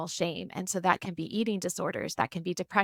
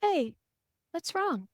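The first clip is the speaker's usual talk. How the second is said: gentle concern; 9 semitones higher, slower than usual. Retold or imitated. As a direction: ask gently like a worried mom. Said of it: "And I go".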